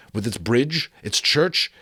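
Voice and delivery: usual speaking voice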